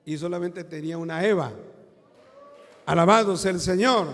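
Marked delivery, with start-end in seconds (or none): 2.9-4.1 loud ringing cry of praise; the preacher shouts his praise to the church; shouted praise. Real, not acted.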